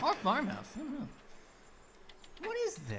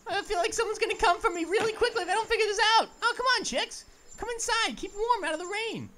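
fast, high, laughing panic; anxious rush; 8 semitones higher, 5 dB louder, faster than usual. Real, not acted. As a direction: hurry it out nervously. Anxious, then urging chicks.

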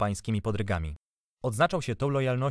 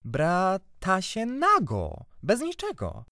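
high, drawn-out obstinate insistence; stubborn; 10 semitones higher, much slower than usual. A narrator's reading. Boy correcting aunt about fraternizing, stubbornly. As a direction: stretch each phrase high, refusing to budge. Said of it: "odparłem uporczywie".

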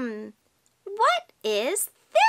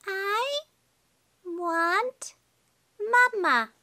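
higher, halting duckling plea; baby duck; 4 semitones higher, much slower than usual. Pretend. Baby duck wanting Mama, high halting voice. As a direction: plead word by word in a little voice. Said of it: "She's just a baby".

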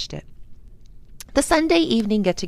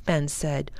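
ordinary delivery, nothing marked

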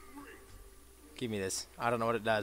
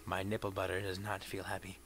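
whispered aside; 10 semitones lower, 15 dB quieter than usual. Real, not acted.

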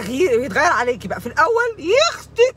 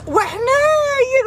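high, tearful comic wailing; mock sobbing lament; 5 semitones higher than usual. Comic protest against controlling men, hammed-up tearful wail. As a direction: wail to heaven in hammed-up grief.

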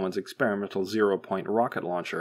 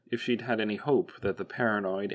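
usual speaking voice

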